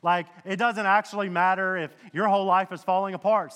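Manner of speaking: ordinary talk, nothing marked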